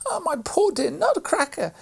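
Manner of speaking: put-on a child wailing over a broken toy; distressed little girl. A narrator's reading. Maria crying out as Fred breaks the Nutcracker, high and anguished. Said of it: "said Maria".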